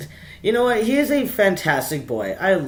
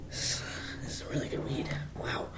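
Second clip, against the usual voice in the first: hushed stoned whisper; 13 dB quieter than usual. Real, not acted. To viewers, a hushed stoned whisper. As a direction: breathe it out under your breath.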